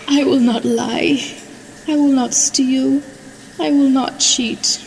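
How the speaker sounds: lightly voiced confessing child; a hint of a small girl owning up; contrite little girl. A narrator's reading. Daughter confesses to mother and brother, lightly childlike and contrite. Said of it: "said out loud".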